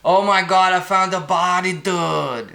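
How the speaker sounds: put-on excited high-pitched Massachusetts accent; Massachusetts guy. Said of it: "That's a guy from Massachusetts".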